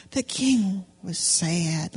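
hammed-up sadness for children; mock sad. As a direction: droop the voice like a sad king. Shows sad face to kids.

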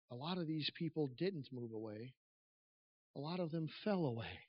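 ordinary talk, nothing marked

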